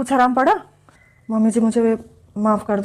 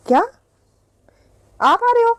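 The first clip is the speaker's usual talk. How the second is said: much higher, startled question; surprised; 13 semitones higher than usual. Acted. Daughter-in-law on the phone with her mother, surprised. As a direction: caught off guard by the news.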